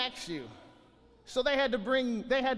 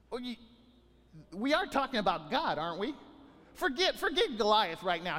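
ordinary talk, nothing marked

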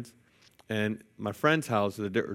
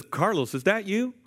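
questioning; 9 semitones higher than usual. Retold or imitated. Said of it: "He says".

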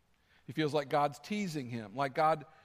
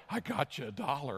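lightly imitated creepy old dude, slightly lowered; a hint of a creepy commercial character, pitched down; 4 semitones lower than usual. Old dude in hip waders offering a dollar, mildly creepy. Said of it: "in the most creepy voice".

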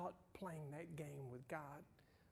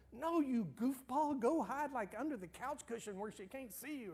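higher and louder, teasing frustration; fond exasperated cry, much higher; exasperated playful call; 9 semitones higher, 5 dB louder than usual. Retold or imitated. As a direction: blurt it out at the silly kid. Wanting to correct hiding grandson.

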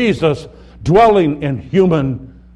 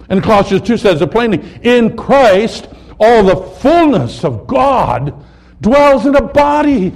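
loud, rising scripture proclamation from the pulpit; preaching at full force; 5 semitones higher, 6 dB louder than usual. Acted. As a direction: proclaim each verse louder and higher, slowing at the climax. Loud scripture reading in sermon.

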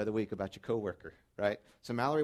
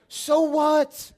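So what? hit much higher and louder; 17 dB louder than usual. Shown as a demonstration. Pastor repeating it to the congregation, now nearly shouting. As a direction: throw So what? out as a near shout.

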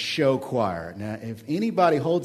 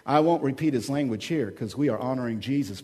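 ordinary talk, nothing marked

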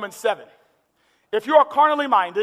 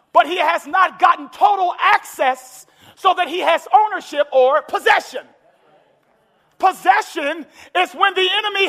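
full-force preaching; 4 semitones higher than usual. Acted. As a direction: preach it to the rafters.

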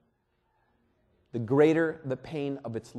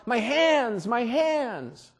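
a higher, mock cry of pain that fades on the repeat; 7 semitones higher, much slower than usual. Pastor imagining Jesus crying about his hands, pained. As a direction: wail over pierced hands. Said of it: "he didn't cry out".

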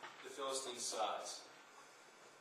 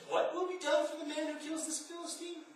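much higher, straining indignant David; incensed David; 10 semitones higher than usual. Acted. Preacher voicing young David's outrage, straining high. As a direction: push the voice up as high as it goes in outrage. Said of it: "My voice can't go that high".

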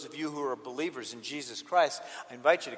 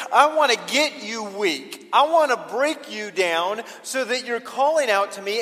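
loud, raised, driving preacher's voice; full-force preaching; 8 semitones higher, 10 dB louder than usual. Acted. Preacher voicing Jesus' answer to the congregation, full-force preaching. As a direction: proclaim it forcefully to the whole room.

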